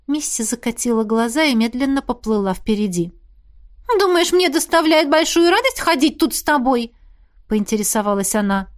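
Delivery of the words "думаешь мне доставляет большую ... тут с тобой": put-on high ghost girl voice. A narrator's reading.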